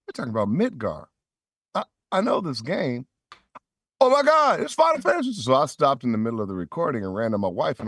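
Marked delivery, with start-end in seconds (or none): 0.1-5.3 building from puzzled to thrilled, much higher; a gamer recognizing his favorite game, voice climbing; growing excitement at the audition script; excited realization. Retold or imitated. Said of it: "I'm like".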